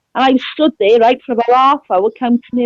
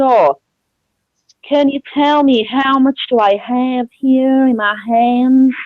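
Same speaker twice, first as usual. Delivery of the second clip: slow Texan drawl; Texas accent impression; slower than usual. Imitating a visitor asking about his coins, slow Texas accent. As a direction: imitate a man from Texas, taking it slowly. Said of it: "do an impression of a certain man from Austin, Texas".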